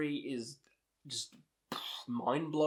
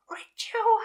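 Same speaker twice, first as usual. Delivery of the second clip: breathy whispered scream, pitched way up; whisper yelling; 7 dB louder than usual. Shown as a demonstration. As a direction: scream it in a whisper so the neighbours can't hear. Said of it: "like, whisper scream it".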